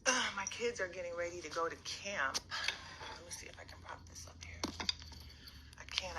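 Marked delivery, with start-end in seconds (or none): 3.0-6.2 soft unvoiced whisper. Real, not acted. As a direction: whisper so the house stays quiet. Whispers while kids get ready.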